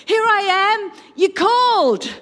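put-on high, very loud child's call; eager boy. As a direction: burst in high and loud, ready to serve. Voices boy Samuel running to Eli.

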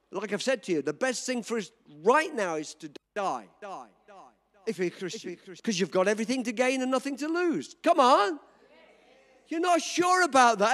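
normal talking voice

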